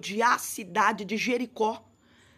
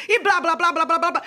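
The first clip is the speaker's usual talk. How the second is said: higher, louder mocking babble; mock chatter; 5 semitones higher, 5 dB louder than usual. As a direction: rattle it off like a nonstop talker. Mocking someone who talks nonstop. (imitando tagarelice, mais agudo e alto)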